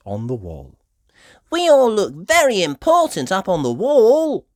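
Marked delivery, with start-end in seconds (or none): 1.5-4.5 put-on high, loud little-girl pride; proudly. A narrator's reading. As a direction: puff up and say it proudly. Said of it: "said Peppa proudly".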